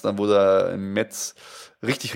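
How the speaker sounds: ordinary talk, nothing marked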